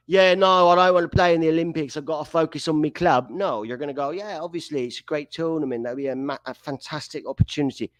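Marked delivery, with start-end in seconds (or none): 0.1-3.3 put-on lightly done British accent, higher and louder; a player brushing off the Olympics in a mock British accent; a hint of a British footballer; generic British footballer. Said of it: "I'm just going with generic Brit". 4.1-7.9 a touch of a British footballer's interview voice; generic British footballer. Retold or imitated. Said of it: "I'm just going with generic Brit".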